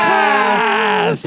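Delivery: high, loud show intro; show intro shout. Pretend. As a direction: yell the show opener. Podcast intro call.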